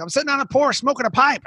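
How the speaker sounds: put-on high, lazy Southern twang of a porch-sitting old veteran; easygoing West Virginia twang, like you're puffing a pipe. Old veteran telling the host what he's up to, in a Southern twang. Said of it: "got a southern twang".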